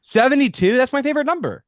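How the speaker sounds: excited. Pretend. Mock excitement.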